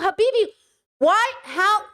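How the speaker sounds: high, pleading cry of disbelief; high exasperated Habibi, why, how. Real, not acted. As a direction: throw up your hands and cry out. Exasperated exclamation at commenter.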